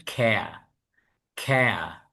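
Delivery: "খেয়া" said with no r at the end, British style; the British way, r left off the end; British pronunciation. Shown as a demonstration. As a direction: demo "খেয়া" dropping the final r sound. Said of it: "ওরা কি বলবে?".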